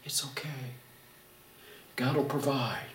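very soft, hushed calming; calm voice of reason. Retold or imitated. Wife calming her panicking husband, soft and calm. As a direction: hush a panicking husband gently. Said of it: "the calm voice of reason".

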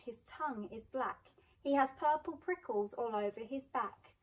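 ordinary delivery, nothing marked

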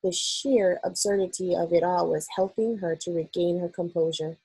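ordinary delivery

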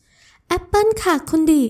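high, slow, doting voice; sweet young woman. Acted. Ramon offers Adisa an apple, high and doting.

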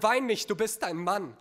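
pent-up, forceful rebuke; wanting to scream. Acted. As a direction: order him sharply not to cry, short of a full shout. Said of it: "ich will schreien".

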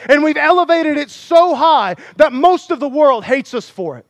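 loud, high, driving sermon delivery; pulpit-pounding preacher in full cry; full-force preaching. Acted. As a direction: preach it hard to the congregation.

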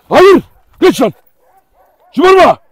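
loud, high shouted orders; shouted commands. Real, not acted. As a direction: shout orders at an unruly dog. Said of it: "Şimdi niye bağırıyorsun derseniz". (bağırarak emir verme)

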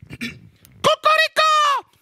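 drawn-out, very high cock-a-doodle-doo; rooster crow. Acted. Preacher waking the congregation as a rooster, crowing. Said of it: "le coq a été créé pour nous réveiller".

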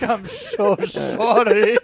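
loud, high, very drawn-out wail; mock wailed apology. Embarrassed roleplayer apologising to the caller, mock wail. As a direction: stretch it into a hammed-up cry.

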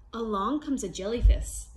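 usual speaking voice

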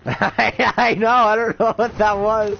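high, loud laughing words. Real, not acted. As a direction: talk through giggles. Laughing at smoker voice.

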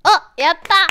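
loud little cheer of relief; happy cheer. Real, not acted. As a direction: celebrate the fix out loud. Voice fixed, cheering. (嬉しそうに声を上げて)

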